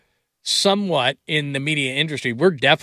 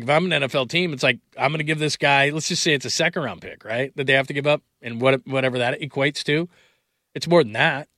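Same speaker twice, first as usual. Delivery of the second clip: usual speaking voice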